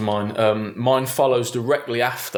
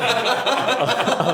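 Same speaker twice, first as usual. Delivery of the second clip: high, slow, through laughter; laughing; 13 semitones higher than usual. Real, not acted. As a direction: laugh the words out. Laughing retelling.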